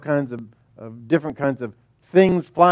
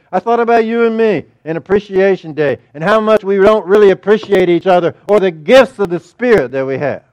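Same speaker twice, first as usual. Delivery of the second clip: louder, urgent preaching; 3 semitones higher, 7 dB louder than usual. Acted. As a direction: drive the point home to the congregation.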